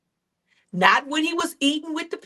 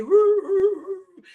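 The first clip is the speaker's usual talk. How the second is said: high howling dog whimper; yelping woo of a dog on a nail; 8 semitones higher, 3 dB louder, slower than usual. Retold or imitated. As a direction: howl high like a hurt dog. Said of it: "the kids walk by every day".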